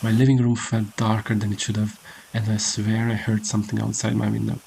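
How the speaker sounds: ordinary delivery, nothing marked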